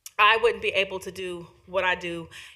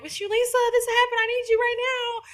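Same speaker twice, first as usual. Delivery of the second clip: much higher, mock panicked young voice; urgent youth caller; 4 dB louder than usual. Client calling advocate at three a.m., high panicked plea. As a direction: play a frantic teen calling for help.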